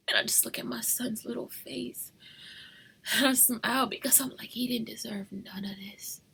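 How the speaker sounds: soft unvoiced whisper. Real, not acted. Mother near sleeping son, whispering.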